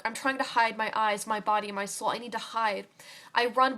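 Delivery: ordinary delivery, nothing marked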